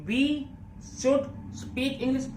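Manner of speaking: very slow, each word set apart. Shown as a demonstration. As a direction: drag it out so learners can follow. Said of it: "slow में बोल रहा हूं ना". (बहुत धीरे, हर शब्द अलग)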